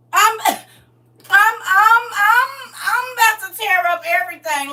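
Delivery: fake-wail like you're about to lose it; hammed-up crying fit; mock crying. Mock rage over pregnancy at 49.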